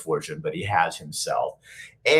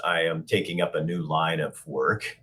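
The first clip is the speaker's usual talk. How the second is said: ordinary delivery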